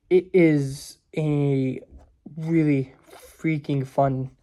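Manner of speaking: slow, savoring emphasis; drawn-out emphasis. Real, not acted. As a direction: stretch each word out slowly. Stretching out emphatic praise.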